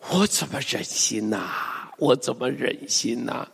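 soft, low, heartbroken sigh; sighing lament. Acted. Preacher voices sudden regret after threatening, sighing. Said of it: "你都要用这种语气来读".

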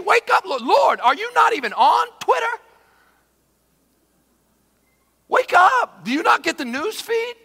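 high, loud, indignant pleading; exasperated shouting. Acted. Believer rebukes a sleeping Lord, high exasperated shouting. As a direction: shout to rouse someone who won't listen.

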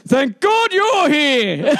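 slow, high call for help, drawn out; mild mock shout of relief; retold loud call. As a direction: stretch it out loud so the bloke hears. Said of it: "I said in a loud voice".

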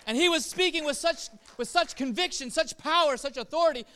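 rising to a loud, high preaching peak; fervent sermon climax; building preaching. Real, not acted. Preacher builds on Jesus' authority.

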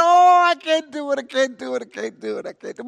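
loud high mock panic, fading away; retold panic. Player balking at the end of the virtual plank, hammed-up panic.

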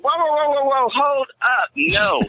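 high, urgent whoa whoa to halt them; alarmed stop. Retold or imitated. Security guard stopping riders at the closed area, urgent high call. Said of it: "I'm like".

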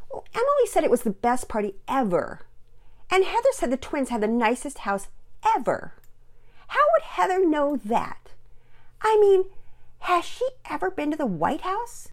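put-on high, loud, snooty little girl; snotty, ungrateful. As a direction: sneer it like an ungrateful child. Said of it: "you sound rather snotty and ungrateful".

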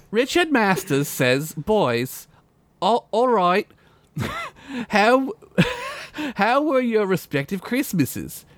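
high, hammed-up orphan begging voice; Rich Masters voice. Host reading a listener email in a mock orphan voice. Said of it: "try to read it in my best Rich Masters voice".

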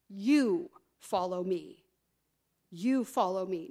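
"You" drawn out, set apart before "follow me"; slow, low, weighty "You"; stress on YOU. Acted. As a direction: lean on "You", then fall away. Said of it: "emphasize the word "you".

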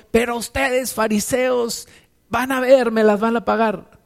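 hammed-up grudge against the Pharisees; imagined Jesus swearing payback; vengeful threat. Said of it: "hubiera guardado todo el rencor".

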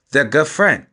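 ordinary delivery

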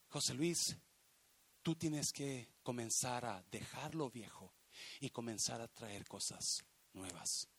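low, soft, measured instruction; God's quiet counsel. Retold or imitated. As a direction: speak as God privately advising. God counsels the preacher, quoted.

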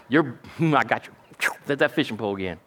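usual speaking voice

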